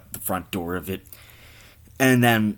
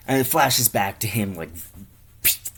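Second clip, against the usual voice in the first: ordinary talk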